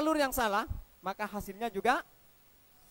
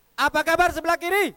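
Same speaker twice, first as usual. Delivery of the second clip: higher, much louder call to the left side; loud greeting shout; 5 semitones higher, 9 dB louder than usual. Shown as a demonstration. Speaker shouting a greeting at the left side of the hall. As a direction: rouse them with a shouted greeting. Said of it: "saya coba tanyakan".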